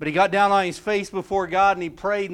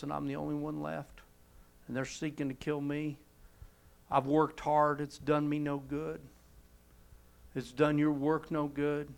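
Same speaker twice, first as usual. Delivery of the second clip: soft, worn-down lament; weary complaint; 2 semitones lower, 7 dB quieter than usual. Retold or imitated. Elijah pours out his complaint to God, soft and defeated. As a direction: let the words sag like a man who has given up.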